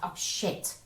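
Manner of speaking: soft whispered curse; hushed dismay. Acted. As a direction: curse under your breath, mock dismay. Joke character reacts to mishap.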